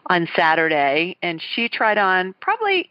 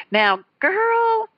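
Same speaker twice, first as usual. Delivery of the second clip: put-on Southern accent, pitched much higher; high-pitched Southern belle accent, teasing; Miss Terry's Southern accent; 13 semitones higher, slower than usual. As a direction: mimic a Southern Alabama lady scolding a bride. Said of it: "Southern accent".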